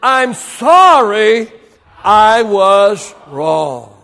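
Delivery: drawn-out, deliberate apology for the church to echo; slow call and response. Shown as a demonstration. Pastor leads the congregation in a slow, drawn-out apology. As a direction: say each word slowly so they can follow. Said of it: "say it with me again".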